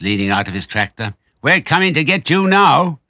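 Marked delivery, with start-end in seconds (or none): 1.4-3.0 high, loud taunting holler; yelled threat. A narrator's reading. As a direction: yell it down at the fox. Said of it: "yelled Bunce".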